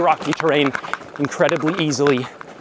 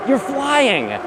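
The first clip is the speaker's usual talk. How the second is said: excited; 10 semitones higher than usual. Real, not acted. Excited bike rider repeats phrase.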